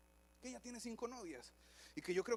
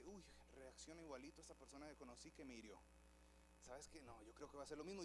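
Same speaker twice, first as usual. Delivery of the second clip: much softer and lower suspicious muttering; wary inner thought; 6 semitones lower, 12 dB quieter than usual. Retold or imitated. Person sizing up a new acquaintance, muttering suspiciously to themselves. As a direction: mutter it to yourself, distrustful.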